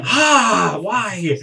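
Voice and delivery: high, loud hammed-up cry; loud acted whine of protest; pained wail. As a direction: wail it as if burnt, half laughing. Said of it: "and then goes".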